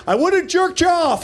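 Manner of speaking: high-pitched parting call; mock calling back. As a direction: toss it back in a comic high voice. Calls back after running off.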